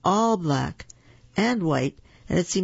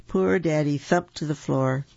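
ordinary delivery, nothing marked

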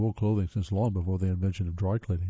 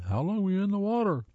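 raised, high-pitched call without full volume; yelled question; 10 semitones higher than usual. A narrator's reading. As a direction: call it out high, as if rousing someone dazed. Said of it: "Nevin yelled".

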